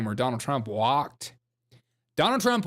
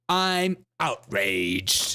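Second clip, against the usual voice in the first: mock flat outrage; 4 semitones lower, much slower than usual. Mocking the guest's flat line.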